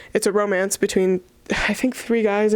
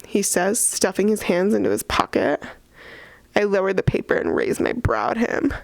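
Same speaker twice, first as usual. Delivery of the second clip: ordinary talk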